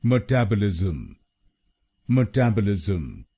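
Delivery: मेटाबॉलिज्म as mə-TAB-ə-lizm, stress on TAB; correct pronunciation. Shown as a demonstration. Teacher modelling मेटाबॉलिज्म for Indian learners, stress on TAB. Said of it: "इसकी सही pronunciation है".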